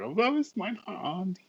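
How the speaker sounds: high, put-on quoting voice; mock quote voice. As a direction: squeak it out in a mock voice.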